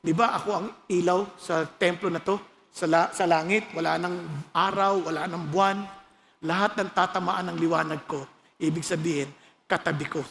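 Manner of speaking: low, hushed retelling of God speaking; lightly voiced God, low and settled; voice of the Lord. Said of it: "Sabi ng Lord".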